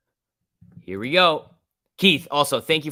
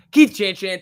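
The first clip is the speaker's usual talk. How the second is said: excited shout-out; 8 semitones higher, 9 dB louder, slower than usual. Acted. Greeting donor loudly.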